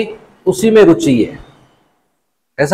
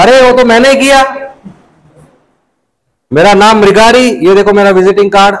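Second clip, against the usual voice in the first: higher, louder eager brag, rushing at the end; loud boastful hunter bragging to the sage; proud hunter boasting; 4 semitones higher, 4 dB louder than usual. Acted. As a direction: show off like a hunter expecting an award.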